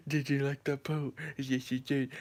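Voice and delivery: softly cooing pet talk with giggly noises; baby voice. Shown as a demonstration. Asking the dog about the pool, baby voice. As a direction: coo over the little dog and dissolve into silly sounds. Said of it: "baby voice to him".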